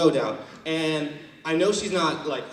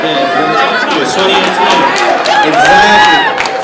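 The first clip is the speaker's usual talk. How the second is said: loud, much higher, defiant proclamation; defiant feminist shout; 4 semitones higher, 15 dB louder, slower than usual. Retold or imitated. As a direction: yell it like a proud declaration at the cashier. Defiant feminist retort at checkout.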